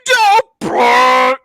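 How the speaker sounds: high, loud, silly cartoon robot; goofy robot voice. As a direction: ham up a dopey robot. Mocking the goofy robot character.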